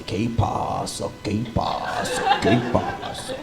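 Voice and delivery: soft, slow breathy repeating under her breath; child muttering. Retold or imitated. Daughter murmuring Spanish around the house. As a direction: whisper it to yourself over and over. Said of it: "She was in the house to say".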